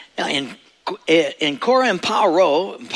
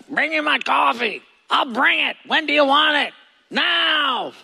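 Bedouin herder impression; 10 semitones higher than usual. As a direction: play a brusque herder shouting for coffee. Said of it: "they always talk like this".

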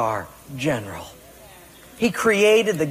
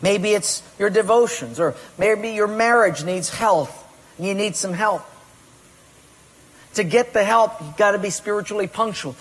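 ordinary delivery

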